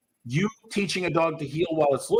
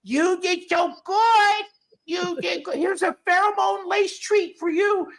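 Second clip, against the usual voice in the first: much higher, slow, gushing praise; mock praise; 4 dB louder than usual. Trainer mocking a gushing owner praising the pup, much higher and slow. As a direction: gush like an overeager dog owner. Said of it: "She does not go".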